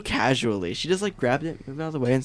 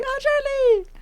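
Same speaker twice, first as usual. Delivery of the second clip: put-on very high, squeaky child voice; little sister impression; slower than usual. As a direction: squeal the name like an adoring kid. Imitates sister hearing the name.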